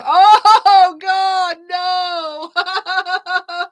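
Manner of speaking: high shouted oh God no; mock horror. Real, not acted. Reader reacting to a double earth chart, mock horror. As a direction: recoil in playful horror.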